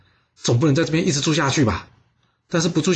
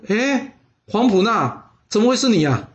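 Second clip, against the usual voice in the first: higher, drawn-out mock astonishment; feigned surprise; 6 semitones higher, slower than usual. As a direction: act amazed to see an old friend. Doctor feigns surprise at friend.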